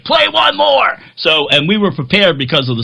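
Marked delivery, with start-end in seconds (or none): 0.0-1.0 much higher and a bit louder, eager; excited fan request. Retold or imitated. Crew member who is a fan asking the band, high and eager. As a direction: plead brightly for an encore. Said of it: "He's like".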